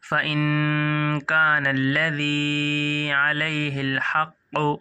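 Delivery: slow, chanted tajweed recitation; Quranic recitation. Shown as a demonstration. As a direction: recite the verse slowly with full tajweed. Recites verse in tajweed style.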